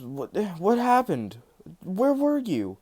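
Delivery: high, shocked questions. Retold or imitated. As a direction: blurt it out in disbelief. Bakugo shocked at Izuku's return.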